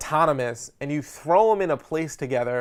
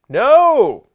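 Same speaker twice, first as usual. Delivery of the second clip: emphatic no; 11 semitones higher, 13 dB louder than usual. Acted. Correcting audience.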